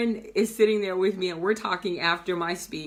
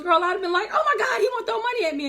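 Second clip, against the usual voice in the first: high, fast, giddy mentee voice with a laugh; hammed-up squeal of disbelief; imitated freak-out; 12 semitones higher, 3 dB louder, much faster than usual. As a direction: act out a friend freaking out over money. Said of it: "I'm freaking out".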